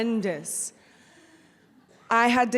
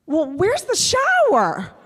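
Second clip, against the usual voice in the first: loud, drawn-out, higher-pitched question; mock complaining mom; 7 semitones higher, 9 dB louder, slower than usual. Daughter imitating mom's reply to the tour, mock complaint. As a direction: imitate an underwhelmed mom, stretching it out.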